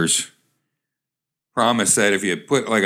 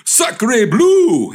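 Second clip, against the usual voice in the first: loud, very high French oath; high startled outburst; startled exclamation; 12 semitones higher, 6 dB louder than usual. Acted. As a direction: exclaim it as if jumping up in shock. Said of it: "exclaimed the man, jumping up".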